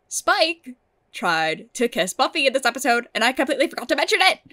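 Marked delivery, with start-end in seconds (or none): 3.1-4.4 rushed, high, loud exasperation; mad at herself. Real, not acted. Reviewer who forgot the kiss, exasperated at herself. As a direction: blurt it out fast and loud. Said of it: "I'm so mad that I forgot this".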